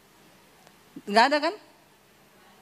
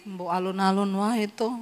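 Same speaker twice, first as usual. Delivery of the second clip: lower, softer husband plea; husband's low soft voice; 6 semitones lower, 5 dB quieter than usual. Retold or imitated. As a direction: quietly imitate the husband.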